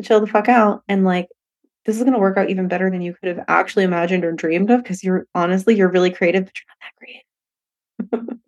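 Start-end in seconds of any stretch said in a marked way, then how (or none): 6.4-8.4 breathy, unvoiced teasing aside; teasing whisper. Future self teasing her, in a slow whisper. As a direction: whisper the jab like a private joke.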